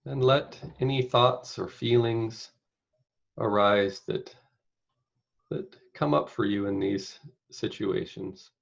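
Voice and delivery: unhurried meditation guide, long pauses between phrases; guided meditation. Acted. Meditation leader inviting listeners to picture caregiving, spacious guided-meditation delivery. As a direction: guide them gently, phrase by phrase, leaving space.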